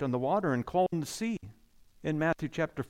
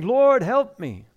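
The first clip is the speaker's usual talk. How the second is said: a loud plea for help, louder; crying out; 4 semitones higher, 8 dB louder, slower than usual. Acted. Woman crying out to Christ for help, loud plea. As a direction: call out to the Lord in need. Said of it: "Then she came and worshiped him, saying".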